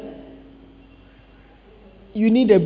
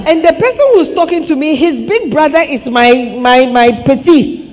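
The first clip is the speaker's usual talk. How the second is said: indignant, loud; 4 semitones higher, 6 dB louder than usual. Real, not acted. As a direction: hammer home the insult. Indignant at being disrespected.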